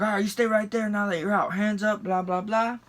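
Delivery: cop's orders. Retold or imitated. Cop walking around to passenger, mimicked orders.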